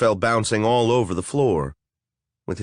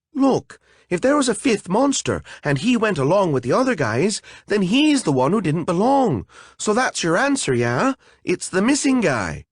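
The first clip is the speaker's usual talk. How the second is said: higher, urgent fairy pleading her case; anxious fairy; 9 semitones higher than usual. Acted. A fairy pushing her answer on the golem, anxious and higher. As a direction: press the answer eagerly, anxious. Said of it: "with anxiety".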